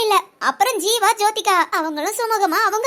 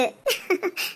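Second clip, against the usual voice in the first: happy laugh; 3 semitones lower, 7 dB quieter than usual. Acted. Happy laugh after the talk.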